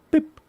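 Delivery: short electronic-style bleep; imitated alert beep. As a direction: mimic a notification bleep. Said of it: "It normally bleeps at me".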